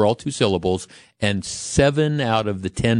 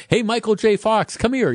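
calling the dog; 12 semitones higher than usual. Pretend. Calling a dog with long name.

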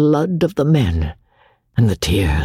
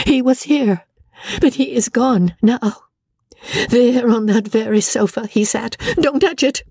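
high, wild, distraught voice; lost in grief, half out of her mind; wilder tone; 10 semitones higher than usual. A narrator's reading. Amelia raves to Rebecca about George, wild and high. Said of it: "she continued in a wilder tone".

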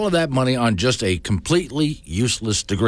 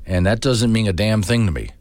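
ordinary delivery

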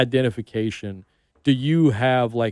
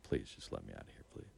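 soft, lowered plea under the breath; silent prayer; 24 dB quieter than usual. Retold or imitated. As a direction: pray quietly that he lets you go. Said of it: "I'm just praying".

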